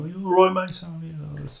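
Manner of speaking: a light mock Birmingham accent, quick and casual. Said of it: "now they got the crazy accent".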